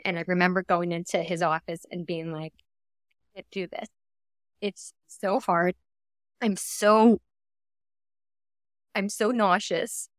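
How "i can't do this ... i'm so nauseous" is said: slow, halting plea relived through tears; choked up, drag out each short phrase; emotional, tearing up. Real, not acted. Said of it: "gosh, that get, that gets me".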